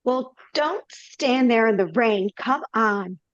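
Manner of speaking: usual speaking voice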